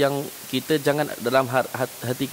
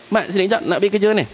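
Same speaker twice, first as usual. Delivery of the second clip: higher call across the room to the worker; boss calling out; 7 semitones higher than usual. Retold or imitated. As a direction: call the employee over, pitched up. Chef ruft erneut.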